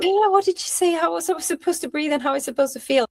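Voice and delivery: high, fast, flustered questions; flustered panic. Retold or imitated. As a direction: act out a panicked, confused mother in labor. Imagined panicked woman in labor.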